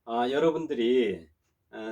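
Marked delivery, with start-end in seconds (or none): none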